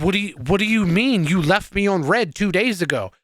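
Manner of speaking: replaying his baffled comeback to her; incredulous retelling. Retelling to podcast cohost his stunned reply to his girlfriend, incredulous.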